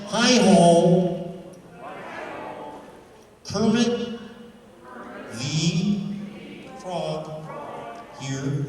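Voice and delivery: high frog voice, rising then dropping; Kermit greeting. Shown as a demonstration. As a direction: stretch Kermit the Frog here slowly. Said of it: "I said".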